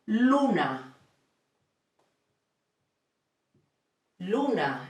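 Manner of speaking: luna with pure u and open a; Spanish luna. Shown as a demonstration. Teacher modelling the word for moon. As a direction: say luna clearly. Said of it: "a Spanish word with U".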